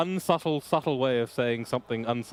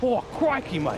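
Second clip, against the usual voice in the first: Steve Irwin; 9 semitones higher than usual. Acted. Streamer answering an Aussie accent request, Australian wildlife-host impression. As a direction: exclaim it as a hammed-up Australian adventurer. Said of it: "a pretty okay Steve Irwin".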